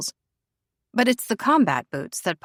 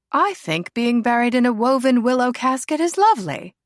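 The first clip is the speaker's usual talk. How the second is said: higher, louder posh aristocrat, delighted; posh lady; 5 semitones higher, 5 dB louder than usual. Acted. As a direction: savour it like a treat. Lady Helena muses posh.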